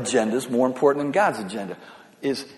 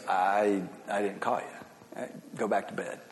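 a hint of a drowsy old priest, softer; sleepy old Eli; 6 semitones lower, 11 dB quieter than usual. Eli half asleep telling Samuel to go back to bed, softly voiced. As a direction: Eli mumbling from his bed, lightly voiced. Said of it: "And Eli goes".

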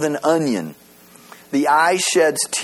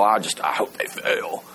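hushed, breathy confession under the breath; whisper; 8 semitones lower, 5 dB quieter than usual. Retold or imitated. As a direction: whisper it like a guilty secret. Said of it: "steer them down a path to go".

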